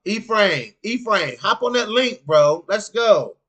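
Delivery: loud, hyped call to a friend. Real, not acted. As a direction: shout him into the stream. Calling a chatter to join.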